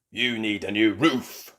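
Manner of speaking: gruff bull voice. A narrator's reading. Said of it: "says Mister Bull".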